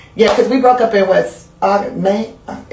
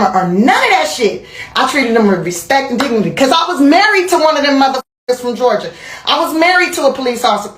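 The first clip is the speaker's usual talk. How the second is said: normal talking voice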